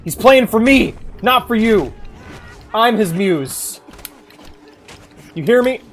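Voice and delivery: loud, cocky taunting; loud playful gloat at a rival; mock taunt. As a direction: yell it at the enemy like trash talk. Taunting an enemy war crier.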